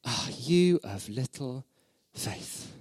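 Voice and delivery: slow, let-down reproach trailing off to a breath; a weary sigh of disappointment, drawn out; disappointed, critical. Retold or imitated. Jesus scolding his doubting followers, slow and disappointed. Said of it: "he's not saying".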